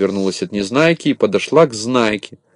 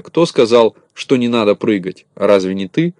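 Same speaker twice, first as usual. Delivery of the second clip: usual speaking voice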